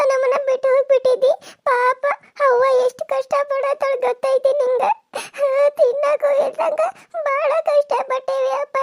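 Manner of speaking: put-on high tearful child's voice, pleading through sobs; a small daughter begging her father, voice breaking with tears; crying little girl. Daughter begs her father not to leave them, crying.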